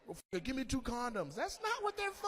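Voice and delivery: high-pitched, climbing to a squeal; pleading, then high-pitched indignation; mock bedroom argument. Lovers squabbling over makeshift earplugs, mock exasperation.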